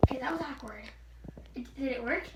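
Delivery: hushed whisper; breathy aside whisper. Real, not acted. Aside after the voice fails.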